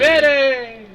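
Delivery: loud celebratory cry; excited shout. Acted. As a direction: shout it for joy. Celebrating verdict.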